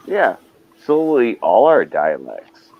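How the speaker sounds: usual speaking voice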